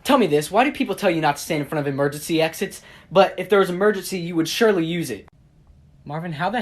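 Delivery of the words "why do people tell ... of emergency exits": ordinary talk, nothing marked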